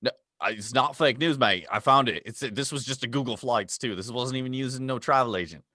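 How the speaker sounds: hammed-up Australian travel host; Aussie accent. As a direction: play the jolly Aussie guide with plenty of mate. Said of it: "with your accent".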